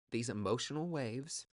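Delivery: normal talking voice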